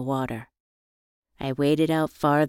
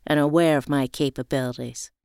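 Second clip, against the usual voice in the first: usual speaking voice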